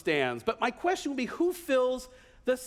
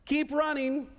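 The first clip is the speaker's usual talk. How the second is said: raised, higher-pitched call to a runner; Noah yelling encouragement; 6 semitones higher than usual. Acted. Noah to a runner passing by, mock yelled encouragement. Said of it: "Noah yells out".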